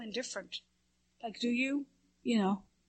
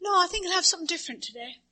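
higher and quicker, a hint of new confidence; lightly voiced brightened woman; 8 semitones higher, faster than usual. Shy woman finally orders her own coffee, lightly brighter. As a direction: give her a small fast lift. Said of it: "And she looks up".

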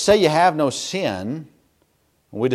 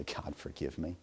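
low, hushed prayer; very soft, humble petition; quiet plea; 10 semitones lower, 21 dB quieter than usual. Retold or imitated. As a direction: ask forgiveness softly, voice dropped. Recalled prayer to God.

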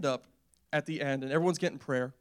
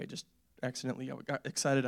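ordinary talk

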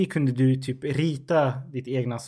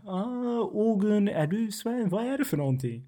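put-on Swedish accent; 8 semitones higher than usual. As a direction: imitate a very Swedish accent, pitched way up. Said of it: "kan göra sin röst så svensk".

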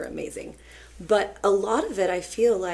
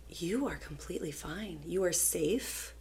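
put-on calm a panicking child, quietly and deliberately; reassuring; 7 dB quieter than usual. Teacher to a panicked student before the fire drill, reassuring.

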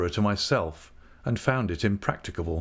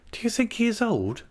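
much higher, astonished question; 13 semitones higher than usual. A narrator's reading. Dora questioning her aunt about Jip's age, astonished. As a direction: ask it high in disbelief. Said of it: "said Dora, astonished".

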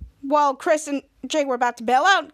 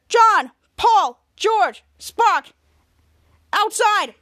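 higher, louder roll call; calling out commands; 5 semitones higher, 6 dB louder, much slower than usual. Acted. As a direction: call each dog sharply, then order it out. Jasper orders dogs out.

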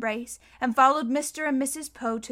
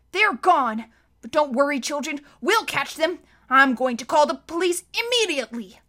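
a louder, higher fussy official; blustering banker; 4 semitones higher, 6 dB louder than usual. A narrator's reading. Mister Poe promising the children to call police. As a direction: reassure the children with brisk bluster.

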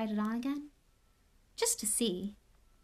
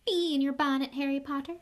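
a small, higher voice in his ear; lightly voiced talking hat, higher; 6 semitones higher, 4 dB louder than usual. A narrator's reading. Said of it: "a small voice said in his ear".

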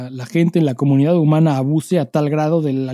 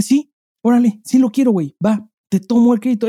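mock eager yes; 6 semitones higher than usual. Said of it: "les dije".

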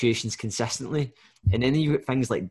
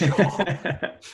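laughing; 7 semitones higher than usual. Real, not acted. Laughing reply to host's laugh.

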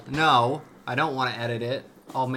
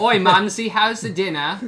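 loud, high Cockney voice; hammy London accent, called out; 8 semitones higher, 8 dB louder than usual. Pretend. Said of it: "a little more Irish".

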